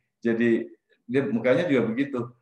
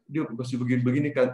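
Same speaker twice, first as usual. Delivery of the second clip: normal talking voice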